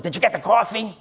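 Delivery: high, put-on cranky voice; mock angry demand. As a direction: imitate a grouch demanding his order. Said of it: "he's angry at the whole world".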